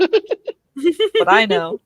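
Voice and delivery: slow, ominous villain line; evil genius voice. Pretend. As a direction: play the rejected mad scientist. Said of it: "You sound like the evil genius".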